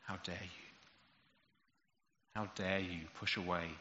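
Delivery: low, grave, hushed rebuke; a quiet but terrible reproach from the Father; stern God the Father. Retold or imitated. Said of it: "the voice of God the Father saying".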